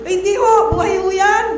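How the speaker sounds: mother pleading loudly with the doctor; insistent plea. Retold or imitated. Mother insists child is alive.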